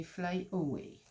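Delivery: English in a French accent, unhurried; poor English accent. Real, not acted. French vlogger reading craft-die words aloud, poor English accent. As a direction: say it slowly, with a real French accent. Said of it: "mon accent anglais est déplorable".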